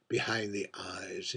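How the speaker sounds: ordinary delivery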